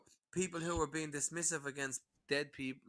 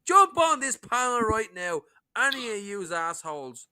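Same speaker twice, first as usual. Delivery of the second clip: loud, higher fired-up dare; angry challenge; 4 semitones higher, 7 dB louder than usual. Real, not acted. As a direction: call out the cowards to their faces. Challenging trolls to debate.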